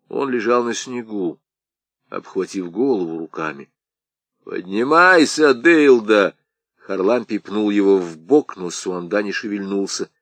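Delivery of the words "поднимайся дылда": loud, slow kick-him-up order; rough order. Acted. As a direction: bark him to his feet. Харлампий пинает Сонду. (громкий грубый окрик)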